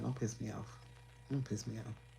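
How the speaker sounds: soft-spoken warning, no heat; calm warning. Shown as a demonstration. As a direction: say it quietly, almost under your breath. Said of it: "You know, I be like".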